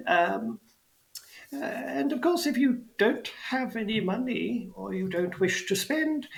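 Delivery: put-on high-pitched old goblin ticket seller; elderly goblin. Goblin explains ticket prices to fairgoers, high elderly goblin.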